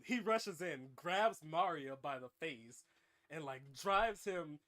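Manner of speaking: ordinary talk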